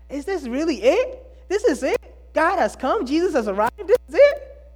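mock the letdown with growing disbelief; incredulous disbelief. Mocking disappointed false teachers.

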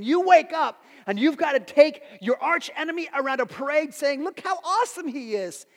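high, loud, incredulous build; worked-up exclaiming. Real, not acted. Preacher recounting Haman's humiliating parade to the congregation, worked up. Acting out Haman's terrible day.

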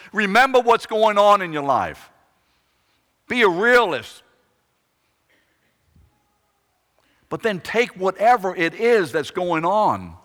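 loud, driving sermon build; preaching at full force. Acted. Preacher piles up David's troubles, loud and building. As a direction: raise the voice and drive the list home.

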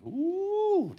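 long, high held "ooh"; delighted "ooh". Real, not acted. Preacher savoring how good it gets, delighted ooh. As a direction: relish it with a high drawn-out ooh. Relishing the passage getting good.

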